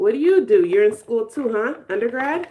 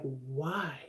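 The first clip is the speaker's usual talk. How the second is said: "Why?" said lower and softer, drawn out in dread; whiny dread; 6 semitones lower, 10 dB quieter than usual. Retold or imitated. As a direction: groan "Why?" like you don't want Monday. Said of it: "you just be like".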